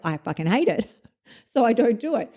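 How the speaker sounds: ordinary talk, nothing marked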